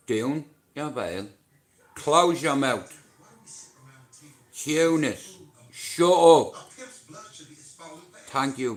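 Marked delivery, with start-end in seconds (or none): 1.9-6.5 loud barked orders at the chat; mock yelling. Streamer yelling at his chat to shut up. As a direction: bark it out loud, mock stern.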